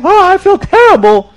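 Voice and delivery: high, loud hammed-up wail; crying like a baby. Sinner confesses to the Baal Shem Tov, mock bawling. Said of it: "crying and screaming in the shul like a baby".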